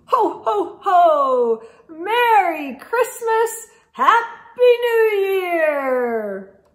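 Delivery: loud, slow jolly ho ho ho; hearty festive Santa call. Acted. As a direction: boom it out like Santa.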